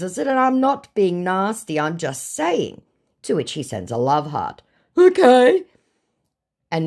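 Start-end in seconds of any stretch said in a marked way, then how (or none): 0.3-2.8 defensive. Acted. Own message to scammer, acted. 5.0-5.6 loud, very high, rising okay; exaggerated high-pitched response; mock okay. Mock reaction to love heart.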